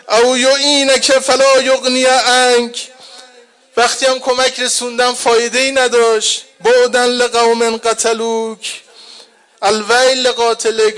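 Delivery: high, loud crying lament; mourning preacher voicing uncle's grief; weeping lament.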